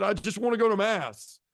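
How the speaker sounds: high-pitched retold plea; mildly hammed-up tearful protest; upset, crying and mad. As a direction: plead it high, imitating your upset self. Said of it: "I was crying and mad".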